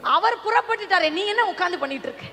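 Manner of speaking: high, loud, driving sermon delivery; full-force preaching. Acted. Preacher rousing those who sit crying, impassioned full-force preaching.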